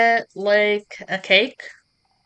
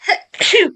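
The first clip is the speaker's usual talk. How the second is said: sudden loud sneezing fit; real sneezing burst; sneeze; 12 semitones higher, 7 dB louder than usual. Real, not acted. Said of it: "sorry, I had to s-, sneeze a bunch".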